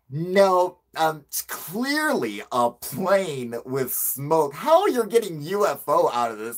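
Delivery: loud, high exasperated objection; calling them out. Retold or imitated. Caller calls out the interviewers on the phone, exasperated. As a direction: push back hard, stretching the words. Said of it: "I'm just calling them out".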